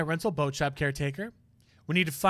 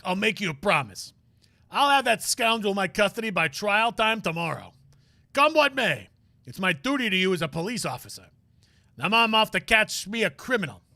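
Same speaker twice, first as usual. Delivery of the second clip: higher, louder gruff detective voice; Gumshoe voice; 6 semitones higher, 7 dB louder than usual. Acted. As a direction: play the eager detective cheering his pal.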